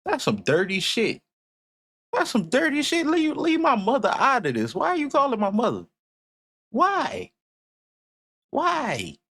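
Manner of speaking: very high-pitched comic indignation; mock outrage. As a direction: squeal out playful protest, voice way up. Mock outrage over calling mother.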